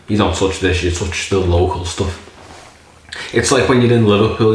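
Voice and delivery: usual speaking voice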